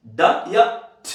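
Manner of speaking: ड as voiced "da", then ठ as bare "t"; contrasted -ed sounds. Shown as a demonstration. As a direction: set ड firmly against a vowelless ठ. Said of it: "wrong, ठ".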